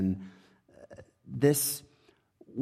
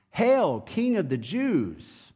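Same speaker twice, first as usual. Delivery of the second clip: higher, drawn-out mock hail; mocking salute; 7 semitones higher, slower than usual. A narrator's reading. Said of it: "when they had mocked him".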